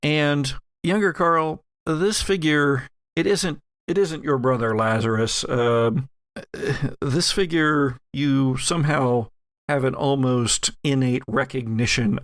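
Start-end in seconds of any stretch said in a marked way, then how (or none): none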